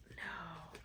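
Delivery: ordinary delivery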